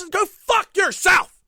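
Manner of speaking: slow, high, drawn-out scream; full-volume comic fury, stretched out; furious yell. Retold or imitated. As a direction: scream each word at the drive-thru. Climax of yelling at drive-thru.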